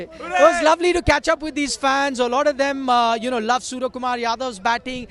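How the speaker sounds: news report. Acted. Reporter's live sign-off report.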